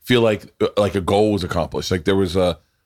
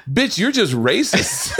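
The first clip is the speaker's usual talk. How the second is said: high, drawn-out comic outrage; exaggerated indignant protest; mock indignation; 11 semitones higher, slower than usual. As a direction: act out an offended comeback, pitched way up. Acted indignant comeback to a psychic.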